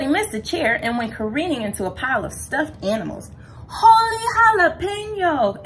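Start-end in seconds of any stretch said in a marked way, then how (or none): 3.7-5.6 high, loud, drawn-out cry of amazement; storybook kitten's astonished outburst; excited exclamation. A narrator's reading. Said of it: "exclaimed Skippy John Jones".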